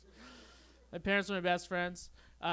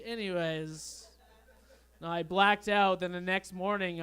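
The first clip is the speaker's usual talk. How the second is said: ordinary delivery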